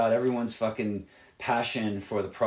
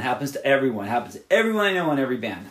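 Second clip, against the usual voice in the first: ordinary talk, nothing marked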